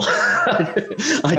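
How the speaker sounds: slow, laughing through the words; amused laugh through the line. Real, not acted. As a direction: chuckle while saying it. Laughs at own joke.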